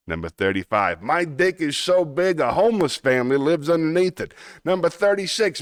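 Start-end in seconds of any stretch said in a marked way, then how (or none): 1.0-4.3 cartoon Brooklyn wiseguy accent; Brooklyn tough guy. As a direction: boast it like a Looney Tunes Brooklyn tough guy. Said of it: "Yes, it's a Brooklyn accent".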